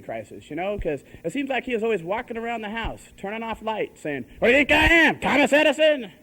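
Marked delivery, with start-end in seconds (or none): 4.4-6.1 loud, high griping as an indignant dad; exasperated dad. Retold or imitated. Dad grumbles about lights left on, exasperated and loud. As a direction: raise your voice like a fed-up father. Dad complaining around the house, retold.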